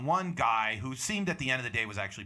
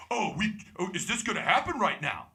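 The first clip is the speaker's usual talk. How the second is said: higher-pitched, put-on challenge; mock indignant; 6 semitones higher than usual. Comic imitates heckler confronting the host, mock indignant. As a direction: imitate someone squaring up, voice jumping up.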